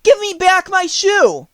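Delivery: high, loud angry demand; furious high-pitched child's demand; angry little girl. A narrator's reading. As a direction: shout it indignantly as an angry girl. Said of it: "grew angry and said".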